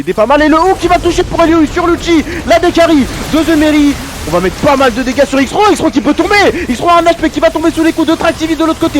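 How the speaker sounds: fast high hype casting; esports caster. Real, not acted. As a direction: shout the fight as it unfolds. Live teamfight play-by-play.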